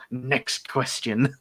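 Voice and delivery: slow, flat-toned mock dismissal; deadpan imitation. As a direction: drag out the brush-off. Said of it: "just says".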